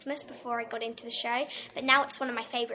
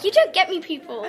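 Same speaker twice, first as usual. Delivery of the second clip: laughing mock despair; 6 semitones higher, 12 dB louder than usual. Mock exasperation at friends.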